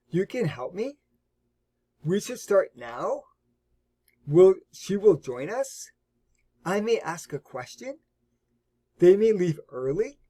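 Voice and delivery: statement turned into a surprised question, voice rising at the end. Shown as a demonstration. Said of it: "say it with a surprising tone".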